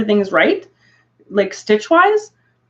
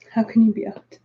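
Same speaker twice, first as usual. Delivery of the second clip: usual speaking voice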